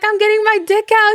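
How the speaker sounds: very high, squeaky with suppressed laughter; laughing imitation. Retelling Rick's phone line to a friend, laughing. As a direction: barely get the mimicked line out for laughing. Repeats acted line, breaking up.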